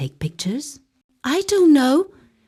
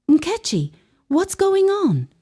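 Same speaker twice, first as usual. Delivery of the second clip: panicked; 11 semitones higher than usual. Acted. Panicked mother on phone.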